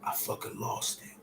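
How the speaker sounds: voiceless, grief-stricken; barely able to get it out; broken whisper. Real, not acted. Recalling family's death.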